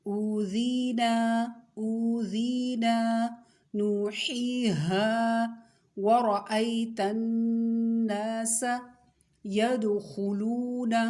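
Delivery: teacher reciting for pupils to copy, vowels drawn out; drawn-out recitation. Shown as a demonstration. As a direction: stretch each long vowel a full alif. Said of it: "सही तलफ़ुज़ के साथ अदा कीजिए".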